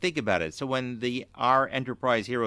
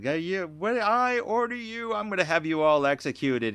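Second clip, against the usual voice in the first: mock Mirror Kirk; 9 semitones higher, 4 dB louder than usual. Cohost imitating Mirror Kirk ordering the guards, high mock pomp. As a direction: lord it over the guards in a put-on voice. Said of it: "It's like".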